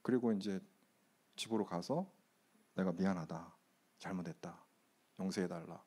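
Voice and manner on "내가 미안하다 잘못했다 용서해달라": low, soft, humbled apology; subdued retold apology. Husband recalling his apology to his wife at home, subdued. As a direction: quote the apology quietly and low.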